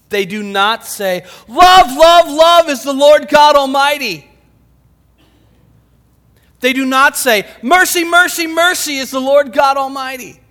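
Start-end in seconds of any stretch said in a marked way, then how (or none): 1.5-4.3 high, full-force shout; booming cry of heavenly praise; screaming declaration. Acted. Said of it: "it's something they're screaming". 7.6-10.4 high, loud proclamation; ringing cry of heavenly praise; screaming declaration. Acted. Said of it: "it's something they're screaming".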